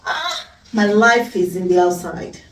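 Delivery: ordinary talk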